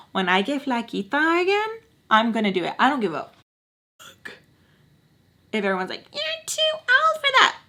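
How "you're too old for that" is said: very high-pitched mimicking voice; mocking squeal. Mocking everyone who says she's too old, in high falsetto.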